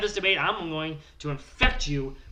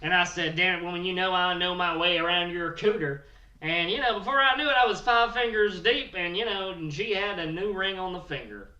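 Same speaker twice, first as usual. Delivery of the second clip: rowdy hillbilly Bill bragging about his wedding news; 3 dB louder than usual. Acted. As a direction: play the brash trailer-park groom, pushed up and loud. Character Bill Morgan holds voice.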